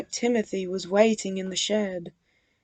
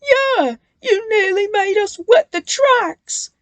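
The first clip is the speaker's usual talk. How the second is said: high, loud crying complaint; tearful agreement; 12 semitones higher, 7 dB louder than usual. A narrator's reading. Ben complaining about the prank, crying. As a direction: whine it tearfully. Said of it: "agreed Ben".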